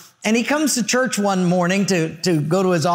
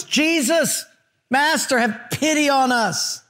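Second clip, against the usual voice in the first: high, drawn-out plea without real volume; calling out; 7 semitones higher, slower than usual. A narrator's reading. Ten lepers calling to Jesus from a distance, high pleading call. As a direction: read it as a pitched-up call from afar. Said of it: "called out in a loud voice".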